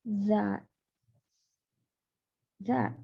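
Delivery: That said as za, soft z start; that with a z sound. Shown as a demonstration. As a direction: That pronounced za. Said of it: "that ini dibacanya kayak gini ya".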